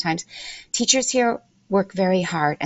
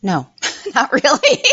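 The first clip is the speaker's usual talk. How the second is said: laughing through the answer; amused, laugh-filled denial; 7 semitones higher, 4 dB louder than usual. Real, not acted. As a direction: break into high, helpless laughter mid-reply. Amused answer to interviewer's question.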